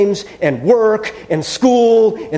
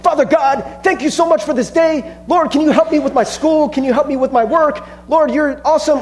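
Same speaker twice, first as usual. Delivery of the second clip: much higher, chirpy hammed-up prayer; mock breezy prayer; 9 semitones higher, 4 dB louder than usual. Preacher mocks a routine prayer to God, higher and chirpy. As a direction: rattle off a shallow prayer in a bright put-on voice. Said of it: "we go to pray and we're like".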